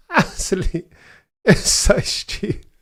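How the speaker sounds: high, squeaky laughed-out words; laughing in disbelief. Real, not acted. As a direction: barely get it out through helpless laughter.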